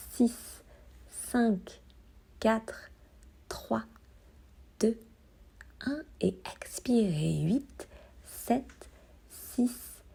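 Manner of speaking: hushed countdown, numbers spaced out by pauses; softly pace someone's breath, number by number; breathing count. Acted. Counting inhale, hold, exhale for listener. (décompte doux et espacé de la respiration)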